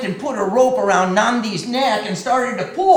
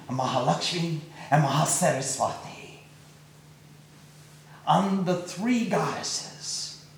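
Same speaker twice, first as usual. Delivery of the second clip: plain reading voice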